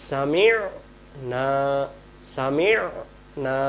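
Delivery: সামি'না very slowly with the ain held; slow correct ain. Shown as a demonstration. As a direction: stretch সামি'না. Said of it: "আদায় করবো কেমন করে". (ধীরে আইন আদায় করে সামি'না)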